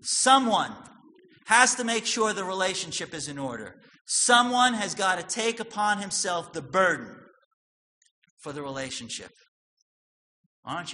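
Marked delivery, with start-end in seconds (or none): 0.0-9.3 loud, raised preaching voice; forceful preaching. Acted. Pastor booming at the congregation, full force preaching. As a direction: hammer it home to the congregation.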